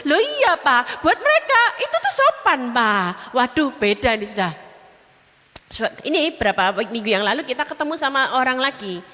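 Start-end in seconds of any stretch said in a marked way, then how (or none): 0.1-3.4 high pleading explanation. Retold or imitated. Explaining youth manners to elder.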